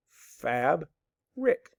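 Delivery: FAB, pause, RICK said as two pieces; split into syllables. Shown as a demonstration. Teacher showing the method, syllables said apart. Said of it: "that's how we do it".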